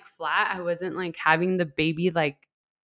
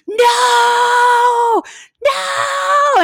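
very high, loud, long scream; re-enacted scream; 7 dB louder than usual. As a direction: scream it out in protest, imitating the moment. Reacting to light switched on.

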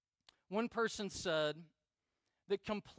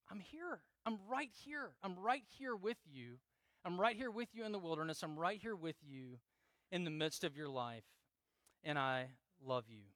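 soft start, settling lower and gentle; hushed, comforting acted words; tender reassurance; 3 semitones lower, 4 dB quieter than usual. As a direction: reassure softly, as God to a frightened child. God voiced reassuring the listener.

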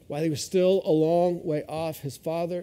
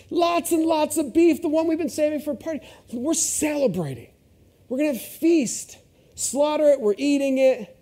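excited; 10 semitones higher than usual. Acted. Preacher joins father's excitement.

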